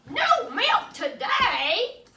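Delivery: high, loud, slow outraged question; shocked cry. Acted. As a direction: cry out in disbelief. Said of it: "cried Farmer Brown".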